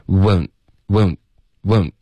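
British "won't" with a small, relaxed glide; isolated British-style takes, each cut off; British pronunciation. Shown as a demonstration. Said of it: "这是一个比较英式的发音".

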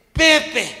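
high, loud stunned cry; spitting out the one word he can; choked exclamation; blurted name. Retold or imitated. Husband blurts brother's name.

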